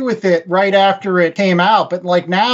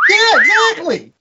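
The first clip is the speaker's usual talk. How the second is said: excited agreement; 10 semitones higher than usual. Real, not acted. Agreeing with "also cute" joke.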